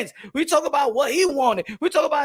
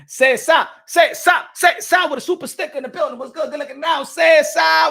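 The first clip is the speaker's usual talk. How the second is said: shout-out; 3 semitones higher, 6 dB louder than usual. Real, not acted. Shouting out chat members.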